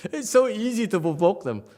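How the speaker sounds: high, loud, through real laughter; laughing. Real, not acted. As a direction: gleefully crack up while saying it. Master laughing at own teasing.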